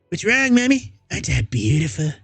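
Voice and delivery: put-on high, eerie childish voice, unsettlingly calm; a disturbed boy explaining himself sweetly to mom; high little-boy voice with creepy calm. [name]'s voice held.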